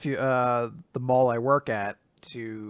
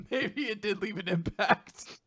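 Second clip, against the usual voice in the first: laughing; 7 semitones higher, 4 dB quieter, slower than usual. Real, not acted. Laughs while finishing own story.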